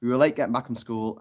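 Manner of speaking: normal talking voice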